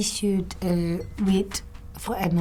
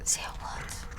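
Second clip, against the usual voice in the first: soft unvoiced whisper of shock; 9 dB quieter than usual. Listener whispering disbelief at the news, hushed. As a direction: gasp it out under your breath.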